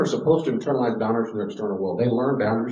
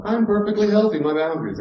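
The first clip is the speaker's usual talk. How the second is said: mock newborn; 11 semitones higher, 4 dB louder than usual. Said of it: "come out of the womb going".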